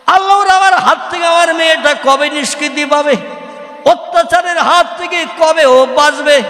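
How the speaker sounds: high, sustained imitated plea to God; pleading father. Father-in-law begging Allah to free his daughter, high acted plea. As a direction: cry out in prayer as a wronged father.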